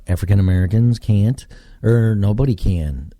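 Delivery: normal talking voice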